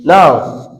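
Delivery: ordinary delivery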